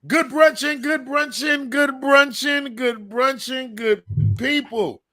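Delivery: loud, high hype greeting that slows; hype opener. Real, not acted. Host opens the show to listeners, hyped and loud. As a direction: call out the show name to the crowd.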